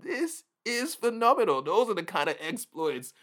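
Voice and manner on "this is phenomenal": awed wonder. Pretend. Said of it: "You're gonna be like".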